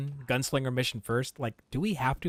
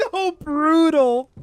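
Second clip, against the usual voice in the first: much higher and louder, slow, stretched out; exaggerated groan of sympathy; 12 semitones higher, 10 dB louder, much slower than usual. Real, not acted. Reacting to Dutch's cold reply with a loud stretched-out wince. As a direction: wince loudly at the cringe moment.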